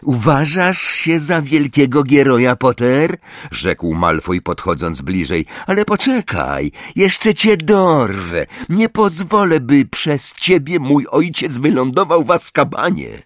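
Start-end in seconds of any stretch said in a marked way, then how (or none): 0.0-3.2 high, sneering schoolboy voice; sneering rival. A narrator's reading. Malfoy sneers at Harry. 5.7-13.2 angry threat. A narrator's reading. Malfoy threatens Harry.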